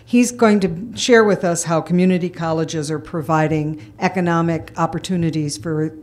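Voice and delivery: ordinary talk, nothing marked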